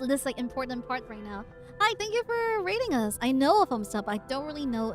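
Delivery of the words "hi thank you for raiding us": cute anime streamer. Real, not acted. Greeting raiders on stream.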